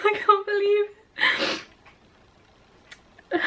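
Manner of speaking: high, crying voice, words catching; tearful disbelief. Real, not acted. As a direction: choke the words out through joyful tears. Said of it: "I don't know if I've cried on camera before".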